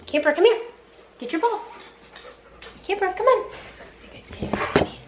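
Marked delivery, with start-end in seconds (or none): 0.1-3.5 coaxing a dog to come, pitched up high; high dog-calling voice. Real, not acted. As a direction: call the dog in a much higher, sing-song voice.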